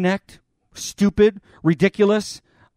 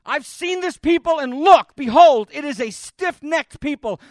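higher and louder on behold; forceful repeat; 6 semitones higher, 3 dB louder than usual. Acted. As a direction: press the complaint harder this time. Preacher repeats God's complaint.

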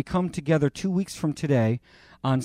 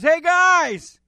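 high, loud call across the water; Jesus calling to the men in the boat, loud and slow; calling from shore; 14 semitones higher, 6 dB louder, slower than usual. Acted. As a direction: hail the fishermen from the beach. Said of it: "hears the Lord call from the shore".

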